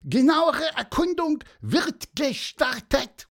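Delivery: high, loud, drawn-out squeak; self-important little bird. A narrator's reading. Pieper starts a closer inspection, high important chirp. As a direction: announce it slowly like a tiny officer.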